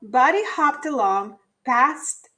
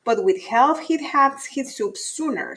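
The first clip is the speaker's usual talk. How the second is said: usual speaking voice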